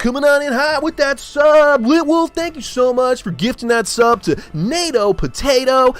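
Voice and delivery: loud, high hype-man shout welcoming a sub; hype announcer. Pretend. Hyping up a new sub to the whole chat, high and loud. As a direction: blast it like an over-the-top streamer hype man.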